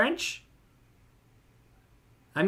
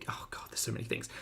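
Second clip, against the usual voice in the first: under-the-breath whisper; whispered aside; 4 semitones lower, 14 dB quieter, faster than usual. Real, not acted. Host rattles off a to-do list: whispered aside. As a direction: whisper it to yourself, overwhelmed.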